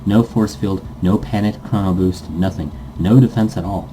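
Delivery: ordinary talk, nothing marked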